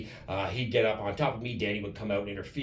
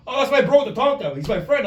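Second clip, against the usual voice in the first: high, loud imitation of a proud pal; enthusiastic self-defence; 12 semitones higher, 9 dB louder than usual. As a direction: imitate him gushing in his own defence. Acted excited defence of his friend.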